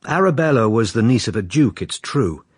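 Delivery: normal talking voice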